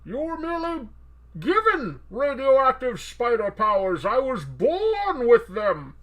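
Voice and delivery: high, loud, drawn-out villain voice; slow, grandiose boast; Tom Hardy as Bane. Acted. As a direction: declaim slowly like a theatrical masked villain. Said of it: "make Venom sound like Tom Hardy as Bane".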